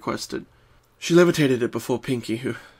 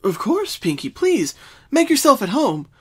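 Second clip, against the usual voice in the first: higher, quick and welcoming; Twilight voice; 12 semitones higher, 5 dB louder than usual. Acted. Twilight welcomes Pinkie inside, higher and quick. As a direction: hurry to make her welcome. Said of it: "Twilight said quickly".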